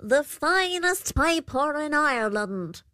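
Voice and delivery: high-pitched creature's proud announcement; high fairy creature voice, showy; Púca creature. A narrator's reading. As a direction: present the piper grandly as the Púca. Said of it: "And the Púca replied".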